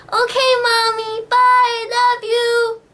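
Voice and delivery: put-on very high, slow, sing-song child; little kid voice. Imitating her child self chatting to mom on a toy phone. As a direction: chirp it like a tiny girl on a toy phone.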